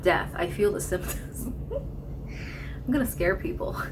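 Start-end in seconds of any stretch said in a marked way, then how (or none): 1.5-2.8 soft breathy giggle; breathy laugh. Real, not acted.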